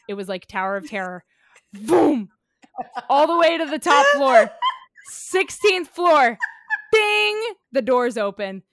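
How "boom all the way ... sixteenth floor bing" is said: loud punchy sound effects; dramatic sound effects. Retold or imitated. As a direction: boom it out like the ride.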